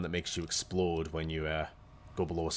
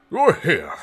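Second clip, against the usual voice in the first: higher, louder eager wizard; exclaiming wizard; 8 semitones higher, 11 dB louder than usual. Acted. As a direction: greet them like an overjoyed wizard. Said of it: "he exclaims".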